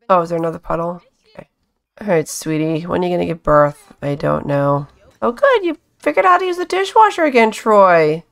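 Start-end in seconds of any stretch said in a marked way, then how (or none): 5.2-7.5 high, quick delighted praise; excited. Real, not acted. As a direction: gush over it. Praising the sim's new skill.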